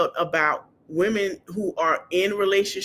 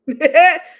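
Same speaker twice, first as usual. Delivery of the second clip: high bursts of real laughter; can't stop laughing; helpless laughter; 8 semitones higher, 10 dB louder than usual. Real, not acted. Laughing too hard to go on about the Set Me Free performance. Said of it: "I can't do this laugh by myself".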